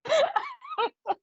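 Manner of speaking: sudden high-pitched giggle that falls away; high burst of laughter. Real, not acted. Medium laughing at a spirit's joke in her ear, high giggle. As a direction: let a real laugh break out, high and falling.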